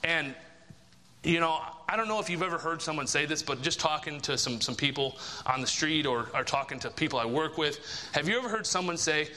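ordinary talk

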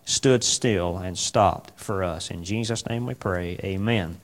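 low, soft prayer voice; subdued, reverent prayer; closing prayer. Real, not acted. Closes an opening prayer.

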